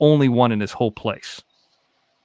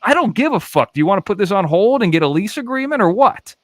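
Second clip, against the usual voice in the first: brusque; 7 semitones higher than usual. Retold or imitated. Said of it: "I'm like".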